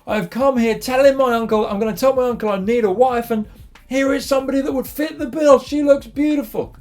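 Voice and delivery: high, thrilled inner voice; acted delight. Jacob sees Rachel in the distance and thinks, delighted. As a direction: play the overjoyed suitor, pitch lifted.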